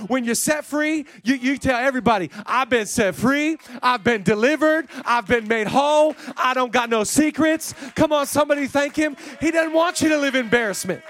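loud, high, emphatic proclamation; full-force preaching. Real, not acted. Preacher telling congregation they are set free, full-force preaching. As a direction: proclaim it loudly, phrase by phrase.

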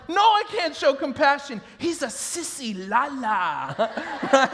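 imitated kid's taunt, drawn out at the end; mock-protest, then stretch out the taunt; mock childish defiance. Boy refusing parents and teasing his brother, mock defiance. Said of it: "And I'm like".

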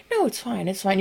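soft self-soothing mumble; hushed acted denial; talking yourself down. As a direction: quietly talk yourself down from panic. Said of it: "talk yourself down".

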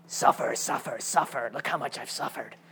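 hushed, low mock voice of suffering; mock sadistical. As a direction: act out a sadistical sufferer under your breath. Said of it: "kind of like sadistical".

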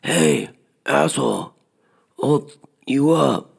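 half-voiced angry holler; retold yell. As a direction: imitate a young guy calling out threats. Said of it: "and he yells".

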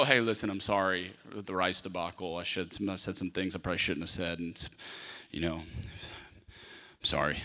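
low, soft, hushed apology; sheepish apology. Retold or imitated. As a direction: quietly own up, a little embarrassed.

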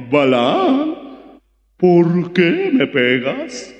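burra quejosa, lenta y algo más fuerte; voz de la burra. Acted. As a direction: habla como una burra dolida. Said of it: "Y la burra habló". (la burra le reclama a Balaam, lenta y quejosa)

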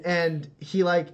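usual speaking voice

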